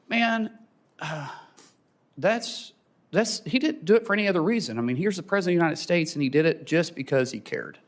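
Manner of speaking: real emotion breaking through; a father moved, barely holding it together; emotional. Real, not acted. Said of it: "if I talk about my kids, I get emotional".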